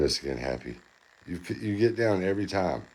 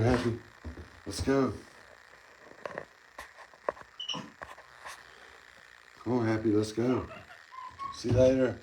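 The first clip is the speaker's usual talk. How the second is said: calling out; 7 semitones higher than usual. Real, not acted. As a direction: call him down cheerfully from across the room. Calling pet monkey again.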